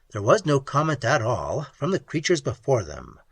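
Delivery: ordinary delivery, nothing marked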